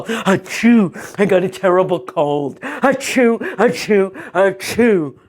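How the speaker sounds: sneezy put-on granny voice; wolf hamming up a cold; sick fake grandma.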